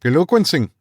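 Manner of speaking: high Afrikaans cry of congratulation; South African cry. A narrator's reading. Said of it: "cried the South African".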